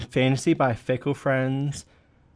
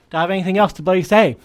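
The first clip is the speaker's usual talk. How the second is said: higher, louder and fast, buzzing with energy; excited outburst; 7 semitones higher, 5 dB louder, faster than usual. Real, not acted. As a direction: rattle it off, wound up and giddy. Hyper rant to audience.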